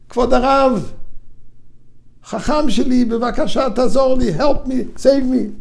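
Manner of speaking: high pleading call to the rabbi; pleading cry. Retold or imitated. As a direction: beg for help, rising to a mock wail.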